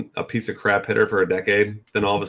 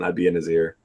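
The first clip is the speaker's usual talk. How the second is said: ordinary delivery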